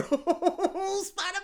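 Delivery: high, drawn-out giddy cry; laughing, long high-pitched cheer; giddy fan squeal. Pretend. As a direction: stretch the name out in a high squeal. Squeals over film out tomorrow.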